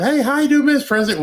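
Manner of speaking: high, loud chirpy hello; hammed-up friendly Biden; mock cheery greeting. Biden greeting the old woman, high and chirpy.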